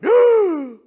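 loud, high mock gasp of horror; big drawn-out burst of shock; shocked outcry. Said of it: "they would have gone".

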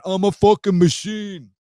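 high, loud Rhode Island mobster imitation; mock tough-guy yell. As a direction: holler the catchphrase in a hammed-up East Coast accent. Repeats the letter's yelled catchphrase.

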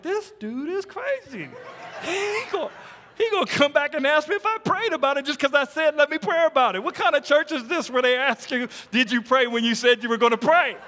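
high-pitched, hammed-up disbelief; mock incredulity. Preacher replaying his shock at being asked if he prayed, mock incredulity.

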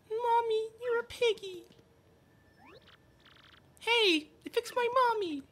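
put-on very high little kid voice; child voice. Children call out to their mother in tiny high voices. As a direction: chirp it like a small excited child.

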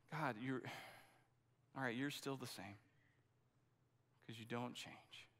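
imitating a weary believer, softly yielding; quiet surrender. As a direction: let it fall away into a soft, spent acceptance. Voicing the acceptance stage prayer.

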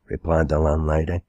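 ordinary delivery, nothing marked

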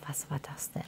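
stunned breathless whisper. Retold or imitated. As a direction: whisper it in shock, barely audible. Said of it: "hab ich gesagt".

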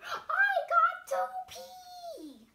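high, loud, slow desperate yell; bursting, pleading shout. Acted. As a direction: blurt it out at the top of your voice. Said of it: "couldn't hold it in any longer".

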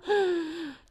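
sharp shocked gasp; acted-out gasp of fright; jadeo de susto. Acts out her shock. (inspiración brusca de espanto)

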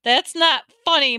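high, loud protest; insistent. A narrator's reading. Mother to the joking attendant, insistent. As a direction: object with indignant force. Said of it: "my mom insisted".

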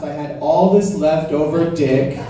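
normal talking voice

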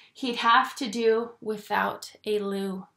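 ordinary delivery, nothing marked

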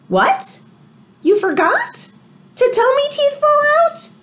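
put-on high, incredulous child voice; surprised kid. A narrator's reading. Teacher voicing the storybook kid's surprise to her class, high and incredulous. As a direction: sound shocked nobody told you. Teacher reads picture book aloud.

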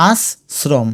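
আশ্রম as as, srom; split in syllables. Shown as a demonstration. Teacher sounding it out, আশ্রম split as-srom. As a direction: pause inside আশ্রম, hissing s in both parts. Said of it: "এটা উচ্চারণ করতে গিয়ে দেখবে".

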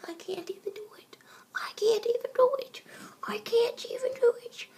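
high, whispery strained voice; high squeaky attempt; high voice try. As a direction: strain upward in pitch. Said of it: "I can't do that one".

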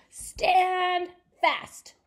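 loud, high call down. Acted. Tim calls down to the wee folk, loud. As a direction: call it down into the hollow. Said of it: "Tim called down into".

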